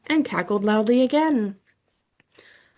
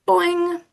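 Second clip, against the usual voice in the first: high happy boing; boing sound; 8 semitones higher than usual. Acted. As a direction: bounce it out. Frog Og jumps for joy.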